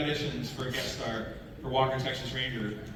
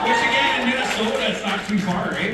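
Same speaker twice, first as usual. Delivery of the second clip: higher, louder hammed-up Minnesota accent; broad Canadian-Minnesotan accent; 6 semitones higher, 6 dB louder than usual. Shown as a demonstration. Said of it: "I like to do my original accent".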